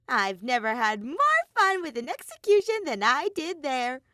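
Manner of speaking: high, loud delighted bear; gleeful. Acted. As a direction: relish the memory with glee. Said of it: "Monokuma admitted gleefully".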